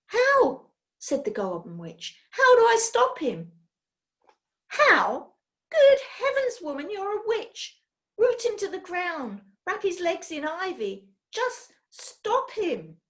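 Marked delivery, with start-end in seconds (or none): 0.1-0.7 very high, loud squeak; a shrill panicked little cry; squeaky goblin witch. Acted. Goblin Witch asking the leader, a very high loud squeak. 2.3-3.5 very high, loud squeak; a shrill panicked little cry; squeaky goblin witch. Acted. Goblin Witch asking the leader, a very high loud squeak. 4.7-13.0 very high, exasperated cry; exasperated witch. Acted. Leader answering the Goblin Witch, very high and exasperated.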